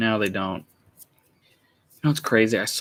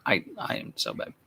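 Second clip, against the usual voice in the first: a low, half-whispered take on a cartoon tough guy; Strong Bad voice; 13 semitones lower, 6 dB quieter than usual. Showing off a figurine, trying out Strong Bad low and breathy. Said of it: "That's a bit closer to strong bad".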